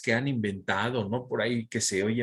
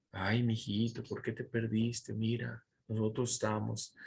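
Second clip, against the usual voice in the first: movie-style tender mother; 3 semitones lower, 7 dB quieter than usual. Retold or imitated. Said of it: "cómo lo ponen en las películas".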